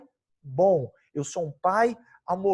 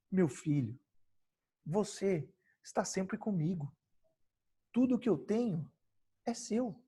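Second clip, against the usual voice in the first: tender father; 9 dB quieter than usual. Acted. Father gently reassures elder son.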